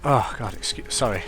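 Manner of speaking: ordinary talk, nothing marked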